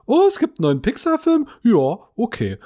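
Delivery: lightly voiced wife, surprised and high; a hint of his wife's delighted surprise; man doing a woman's voice. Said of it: "So machst du deine Frau nach".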